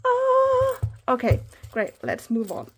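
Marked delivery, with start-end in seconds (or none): 0.1-0.8 high, loud "ah" of delight; delighted squeal. Real, not acted. Delight over the pouch.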